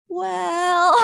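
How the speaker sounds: high, drawn-out mock-hedging "well"; caught-out kid, played for laughs; sheepish teen admission. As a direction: squeak it out guiltily, breaking into laughter. Teen hedging, reluctant confession, storyteller laughs.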